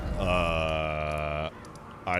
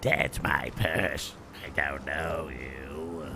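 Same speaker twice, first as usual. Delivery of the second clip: slow put-on woman's voice, softer at the end; mock offended voice; woman impression; 5 semitones lower, 4 dB quieter, much slower than usual. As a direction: play Bobby's indignant line slowly. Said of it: "I can be a woman".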